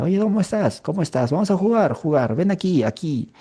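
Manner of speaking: put-on high, sing-song voice coaxing a small child; playful high grown-up talking to a little kid; coaxing adult. Shown as a demonstration. Adult coaxing a child, echolalia example.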